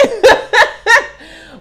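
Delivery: loud high cackle; high laugh. Real, not acted. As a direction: burst out laughing.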